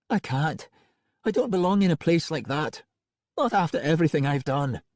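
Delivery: high, aching voice of a grieving wife; tearful wife Angelica. Acted. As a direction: play her as a broken woman reunited with her love.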